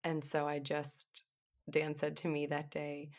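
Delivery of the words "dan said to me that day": ordinary delivery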